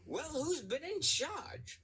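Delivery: high, slow demanding controller; accented controller. A narrator's reading. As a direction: demand to know who's in charge. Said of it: "cried Mister Power".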